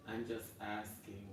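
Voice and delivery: quiet, slow mock-innocent repeat. Real, not acted. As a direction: drop the voice and drag it out, mock innocent. Defensive aside, repeated.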